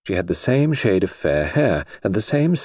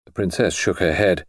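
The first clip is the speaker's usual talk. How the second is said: usual speaking voice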